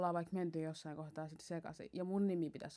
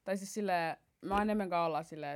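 ordinary talk, nothing marked

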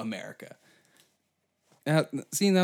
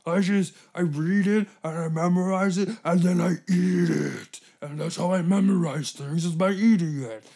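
louder, higher put-on voice, proud of a silly habit; goofy Chambers voice; 6 semitones higher, 5 dB louder than usual. Chambers explaining he eats documents, loud goofy voice. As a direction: boast it like a proud oddball.

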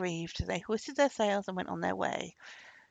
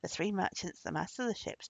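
usual speaking voice

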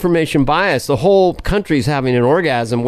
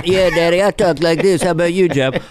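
George Bush. Acted. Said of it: "W and Senior".

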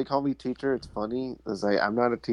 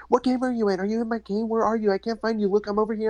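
put-on high, chattering child voice; squeaky kids calling to each other; gaming kids; 10 semitones higher, faster than usual. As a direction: imitate excited kids over headsets. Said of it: "they were just all like".

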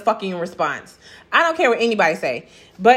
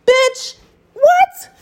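shrieked high, louder what in disbelief; outraged disbelief; 5 dB louder than usual. Real, not acted. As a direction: screech it like you can't believe it. Reading his absurd name question.

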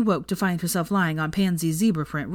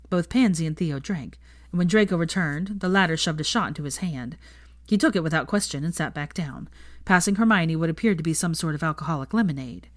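usual speaking voice

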